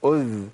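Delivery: أذ with the ذ held, slow; sustained dhal. Shown as a demonstration. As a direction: hold the ذ in أذ briefly. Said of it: "مشي الذال".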